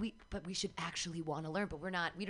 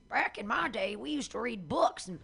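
put-on old-timer voice; 5 semitones higher, 3 dB louder than usual. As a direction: play a crusty grandpa lecturing youth. Mock old-timer nostalgia rant.

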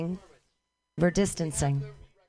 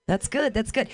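normal talking voice

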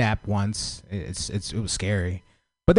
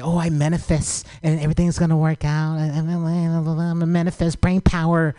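mocking manifesters; 4 semitones higher than usual. Mocks people who manifest.

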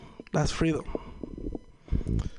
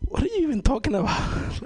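baffled; 7 semitones higher than usual. Retold or imitated. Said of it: "and you're like".

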